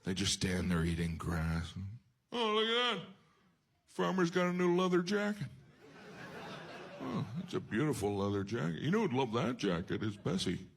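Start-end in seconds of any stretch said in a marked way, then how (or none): 2.3-10.6 high, cheerful oblivious cow; clueless cow. Retold or imitated. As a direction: chat like a naive cow. Cow voiced admiring jacket.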